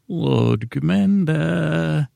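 a hint of Stannis, dragged out slowly; Stannis impression. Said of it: "that's what Stannis says".

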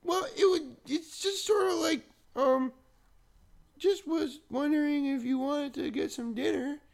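put-on very high childish voice; sweet small child asking; little kid voice. Shown as a demonstration. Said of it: "a little kid voice".

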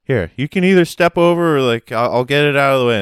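high, loud, overly apologetic Canadian; polite Canadian guy. Retold or imitated. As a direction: gush apologies like an eager worker. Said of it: "And he was like".

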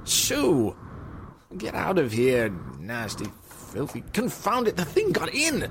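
angry, rattled cries at an intruder; alarmed shooing. Acted. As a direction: shoo it off, voice rising in alarm. Shooing a bat out the window.

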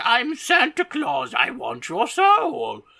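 evil Santa. Pretend. Imagining Santa waking up to kill everyone, hammed-up slow menace. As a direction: drag it out like a cartoon villain Santa. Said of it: "he's gonna be like".

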